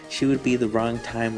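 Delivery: ordinary talk, nothing marked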